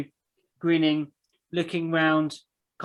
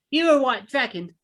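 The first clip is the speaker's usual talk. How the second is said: put-on higher, cheeky child's voice; determined small child; 9 semitones higher, 4 dB louder than usual. Small child marching up to a stranger, determined kid voice. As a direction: pipe up like a determined little kid.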